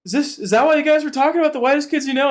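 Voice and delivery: high, fast flustered question; mock-flustered high-pitched question; silly confused self. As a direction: rush it out high, feeling foolish. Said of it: "I felt very silly being like".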